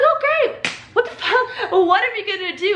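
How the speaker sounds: retold panic, half laughing; panicked excitement. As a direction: freak out in a rush, voice jumping up. Panicking as the friend arrives.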